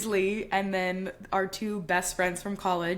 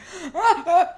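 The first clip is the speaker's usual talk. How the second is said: loud, high sobbing gasps; hammed-up breakup sob; imitated sobbing gasp; 8 semitones higher, 7 dB louder than usual. Shown as a demonstration. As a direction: demonstrate visceral sobbing. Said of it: "sobbing, like".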